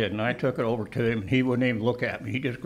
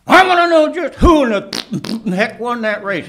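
loud, higher indignant demand; angry cowboy; 9 semitones higher, 9 dB louder than usual. Retold or imitated. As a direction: demand it like a sore loser who won't accept it. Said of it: "he says".

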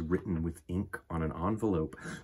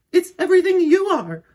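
loving mother; 13 dB louder than usual. Acted. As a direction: sing out a welcome to her child. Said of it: "said her mother".

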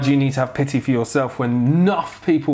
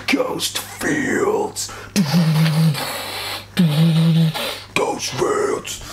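eerie whispered name; whispering; 3 semitones higher, much slower than usual. Pretend. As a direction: breathe it like a ghost.

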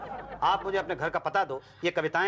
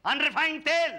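angry shouting; 6 semitones higher than usual. Acted. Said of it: "क्यों चिल्ला रहे हो?". (चिल्लाकर तुकबंदी में शिकायत)

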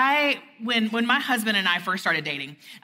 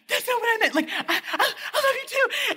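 much higher, rushed and flustered. Retold or imitated. To her boyfriend who thinks she mocks him, high and flustered. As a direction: scramble to fix it, voice way up. Flustered reply amid laughter.

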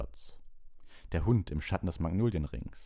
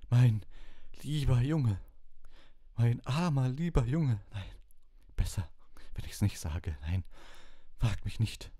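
anguished seer; 8 semitones higher than usual. A narrator's reading. Trelawney to Harry, clutching her heart in a high theatrical wail. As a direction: moan it like a prophetess overcome by a vision.

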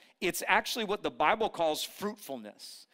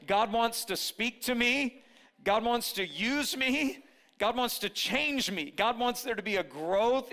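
emphatic preaching; 5 semitones higher than usual. Acted. Preacher building emphatic declarations.

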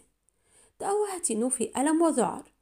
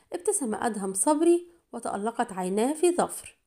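plain reading voice

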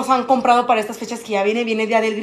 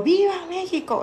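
high, half-volume mock shout; imitated cheer; 6 semitones higher, 4 dB quieter than usual. Showing viewers how crowds shout on Día del Grito, mock cheer. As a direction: hold back a patriotic cheer, pitched up. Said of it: "gritan así como".

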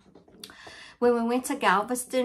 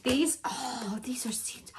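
breathless awed whisper over the gift; real teary whisper; 5 semitones higher than usual. Real, not acted. Whispering to viewers over her friend's gift, teary and awed. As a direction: hush it, overwhelmed and close to tears.

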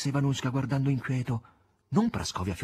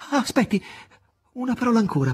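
higher, louder urgent plea; pleading; 5 dB louder, faster than usual. Acted. Ivan begs the departing master to stay, pleading. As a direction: beg him to stay one more moment. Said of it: "pregò Ivan".

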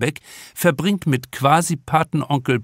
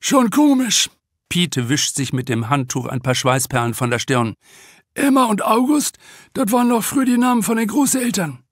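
much higher character voice, fast at the end; 7 semitones higher than usual. Acted. Regular guest Piet musing to Antje about old names, higher character voice. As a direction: muse with amused surprise.

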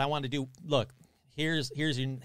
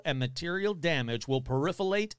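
ordinary delivery

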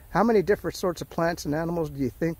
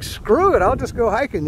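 louder, higher mock-defiant "screw it"; defiant outburst; 6 semitones higher, 5 dB louder than usual. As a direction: toss off the rules with a retold outburst. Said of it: "and I was like".

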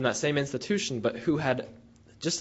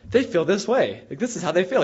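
higher and louder, a retold disbelief; astonished; 7 semitones higher, 7 dB louder than usual. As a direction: sound amazed at what the women say. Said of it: "I could see the surprise in his face".

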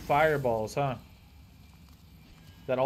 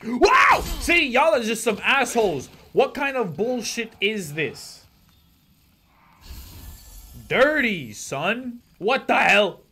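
loud outraged yelling at enemies; raging gamer; 7 semitones higher, 5 dB louder than usual. Real, not acted. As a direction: rage at the game, shouting.

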